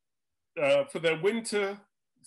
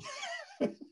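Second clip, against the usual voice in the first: quick high laugh breaking into the quote; short laugh; 8 dB quieter than usual. Real, not acted. Quoting the film director's comment, breaking into a quick laugh. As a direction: laugh through the director's words.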